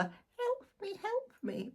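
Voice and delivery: squeaky high cry of help me, help me; tiny fly voice. Retold or imitated. Reader to viewers, mimicking the fly film in a high squeaky voice. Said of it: "the original move of the fly".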